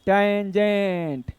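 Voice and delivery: Tangent drawn out very slowly, syllable by syllable; teacher slowly dictating tangent; slow spelled-out term. Shown as a demonstration. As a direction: stretch tangent out slowly so students catch each sound. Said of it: "तो इसका नाम क्या रखते हैं?".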